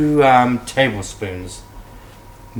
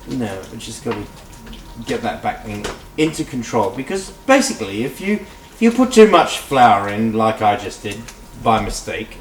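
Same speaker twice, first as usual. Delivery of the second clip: ordinary delivery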